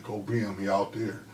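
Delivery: a mother speaking low and steady to her son; calm quiet mother. Retold or imitated. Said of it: "calm, cool, and collected".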